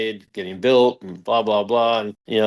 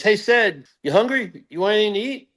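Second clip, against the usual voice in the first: higher-pitched mock call-out; imitated yell; 7 semitones higher than usual. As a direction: call out to someone suddenly, half yelling. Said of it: "all of a sudden he yells".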